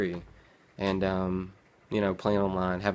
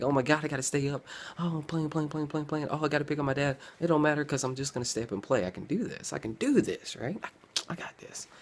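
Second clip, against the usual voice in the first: higher, fast self-assured gamer voice; cocky young self; 6 semitones higher than usual. Retold or imitated. Teen gamer boasting to himself, higher and fast. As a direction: play the overconfident kid who can stay up. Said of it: "I'm like".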